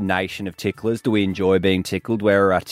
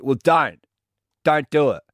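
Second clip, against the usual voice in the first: ordinary talk, nothing marked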